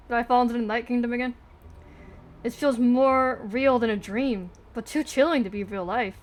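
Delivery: ordinary talk, nothing marked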